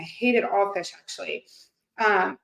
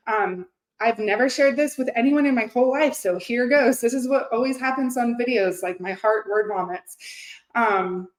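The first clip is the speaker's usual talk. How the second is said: normal talking voice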